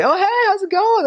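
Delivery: very high, hammed-up friendly hail; cheery greeting. Missionary greeting cop friends around town, exaggeratedly cheery. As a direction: call out to pals in a squeaky voice.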